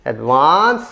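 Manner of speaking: Advance with a long, rising second syllable; stress on second syllable. Shown as a demonstration. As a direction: lean on वांस in advance. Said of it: "stress on the second syllable". (Advance के दूसरे हिस्से वांस पर ज़ोर)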